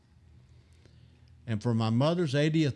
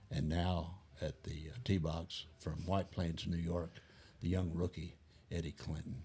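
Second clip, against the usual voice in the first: much softer, lower hushed golf announcer call; 5 semitones lower, 10 dB quieter than usual. Retold or imitated. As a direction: murmur it low like a TV golf commentator at the tee. Golf announcer mocking tee shot.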